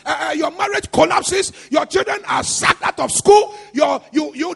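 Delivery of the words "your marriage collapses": ordinary talk, nothing marked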